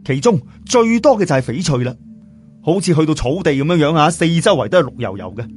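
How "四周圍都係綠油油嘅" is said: usual speaking voice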